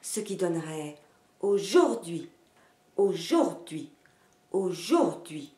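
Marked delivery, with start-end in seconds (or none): 1.4-5.5 wrongly accent the middle of aujourd'hui, on "jour"; aujourd'hui as oh-JOUR-dui, foreign-sounding rhythm; stress on middle syllable. Shown as a demonstration. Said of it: "l'accent sur la syllabe du milieu".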